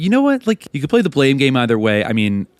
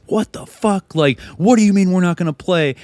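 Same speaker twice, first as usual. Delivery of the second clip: mock outrage; 8 semitones higher than usual. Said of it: "them just".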